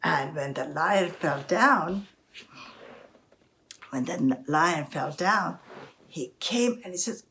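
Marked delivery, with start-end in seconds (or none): none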